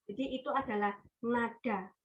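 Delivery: ordinary talk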